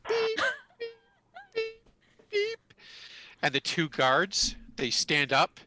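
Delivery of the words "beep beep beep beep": very high, evenly spaced beeps; imitated alarm beeps. Game master imitating the office alarm going off, high beeping. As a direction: mimic an electronic alarm in a squeaky high pitch. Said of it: "an alarm goes off".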